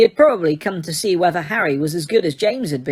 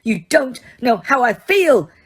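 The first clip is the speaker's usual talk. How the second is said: higher, louder roar; 6 semitones higher, 4 dB louder than usual. A narrator's reading. Harry roaring at Dumbledore, loud. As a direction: roar it at him. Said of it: "Harry roared".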